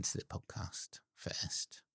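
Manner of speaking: whispering. Real, not acted. Whispered aside while co-host reads.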